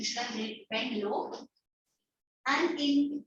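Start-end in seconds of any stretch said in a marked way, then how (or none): 0.0-1.3 soft and lower, humbly voiced; hushed, low-pitched humble question; whispering humbleness. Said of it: "and whispering humbleness".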